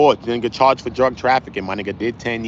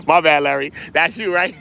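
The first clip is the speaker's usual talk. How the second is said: usual speaking voice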